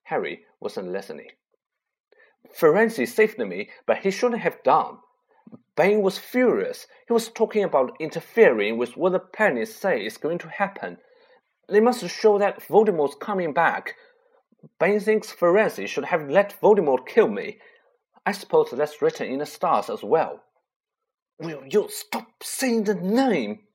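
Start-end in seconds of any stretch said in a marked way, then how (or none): 2.5-20.4 high, loud urgent outpouring; feverish agitation. Acted. Harry feverish, still shaking. 21.4-23.7 slow, drawn fearful hiss. Acted. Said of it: "Ron hissed".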